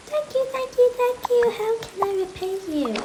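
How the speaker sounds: high squeaky thank-you; grateful toy voice. Acted. Polar bear toy thanking the children, high voice.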